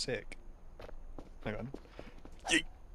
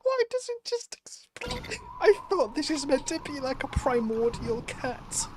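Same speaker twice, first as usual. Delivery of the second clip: very high, laughter-strained voice; giggling questions; 11 semitones higher than usual. Real, not acted. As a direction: squeak it out mid-laugh. Laughing at exploding character.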